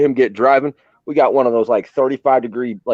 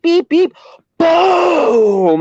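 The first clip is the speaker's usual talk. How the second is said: imitated beeps and boom; 14 semitones higher, much slower than usual. Describing the firework blast to friends, high beeps then a shouted boom. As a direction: mimic the countdown and blast.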